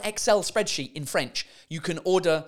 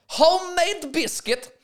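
higher, drawn-out mock announcement; 12 semitones higher, 4 dB louder, slower than usual. Shown as a demonstration. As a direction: read it out slowly with comic relish. Reads out mistranslated menu item, amused.

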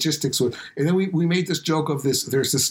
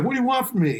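gruff guard, pitched higher; Brooklyn museum guard; 11 semitones higher, faster than usual. Retold or imitated. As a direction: play the irritated New York guard. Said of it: "I can't imitate the Brooklyn accent".

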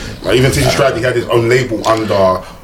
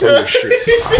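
laughing. Real, not acted. Laughing defiance about the podcast.

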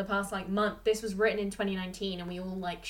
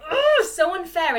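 high, loud exasperated groan into words; indignant, pitched-up outburst; exasperated venting; 8 semitones higher, 8 dB louder than usual. Real, not acted. Venting at the character's unfairness.

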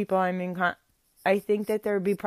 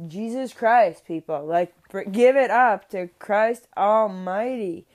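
louder, slower exasperated plea at everyone; exasperated outcry; 3 semitones higher, 8 dB louder than usual. Retold or imitated. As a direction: throw up your hands and call out to everyone. Exasperated outburst from the sidelines.

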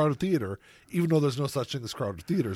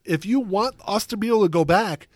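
ordinary talk, nothing marked

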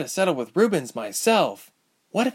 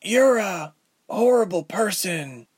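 grated voice; 11 semitones higher, slower than usual. Acted. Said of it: "her voice grated".

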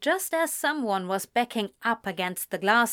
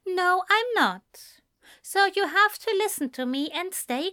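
higher voiced uncle figure, calm but firm with a child; Ezra, a patient guardian; 7 semitones higher than usual. A narrator's reading. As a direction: set gentle rules for a little boy. Ezra voiced gently instructing young Adam.

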